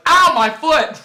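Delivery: high, loud cry of fake pain; hammed-up shout of agony; mock pained yell. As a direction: yelp like a B-movie shark victim. Acting man bitten in water.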